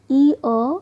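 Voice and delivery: ई अ said as two vowel parts, ee then uh; glide broken down. Shown as a demonstration. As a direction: sound out ई अ slowly for a learner. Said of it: "ईयर वाली glides है". (ई अ के दोनों स्वर साफ़ दिखाकर)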